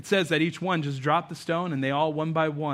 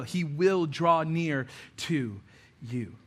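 slow, emphatic; 2 semitones lower, much slower than usual. Real, not acted. Closing emphasis.

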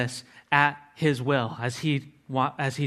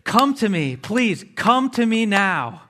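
higher and louder, slow pleading call; urgent exclamation; 6 semitones higher, 5 dB louder, slower than usual. Shown as a demonstration. Preacher showing how Jesus beckons, urgent and loud. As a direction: beckon people to please come, urgently. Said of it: "It's supposed to be said urgently".

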